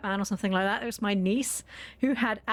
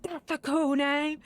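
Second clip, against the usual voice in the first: put-on admiring kids; 7 semitones higher, slower than usual. Classmates admiring the name.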